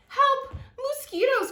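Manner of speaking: high, loud cry for help. Acted. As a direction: girls shriek at the mosquitoes. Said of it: "cried the girls".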